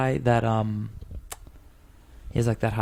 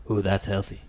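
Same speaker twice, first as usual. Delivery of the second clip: lightly voiced friend impression; a hint of an impression, barely changed; Tinez voice; 3 semitones lower than usual. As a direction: friend doing a low-key Tinez impression. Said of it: "My Tinez voice is like".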